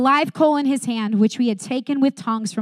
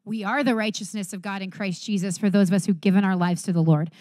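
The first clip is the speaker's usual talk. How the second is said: ordinary talk, nothing marked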